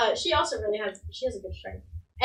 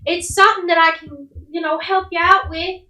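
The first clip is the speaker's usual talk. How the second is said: higher-pitched village woman; helpful mom, pitched up; hospitable mother; 6 semitones higher, 4 dB louder than usual. Acted. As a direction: offer shelter in a high motherly voice. Plays the mother offering shelter.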